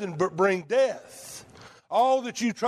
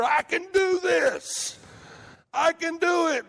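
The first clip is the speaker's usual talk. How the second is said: stubborn boast; 7 semitones higher than usual. Retold or imitated. Voicing stubborn self-reliance.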